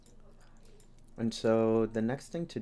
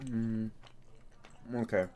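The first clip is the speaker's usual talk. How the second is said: chewing while talking; 6 dB quieter than usual. Real, not acted. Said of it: "excuse the chewing".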